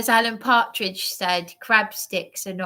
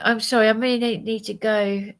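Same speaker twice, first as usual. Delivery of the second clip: ordinary delivery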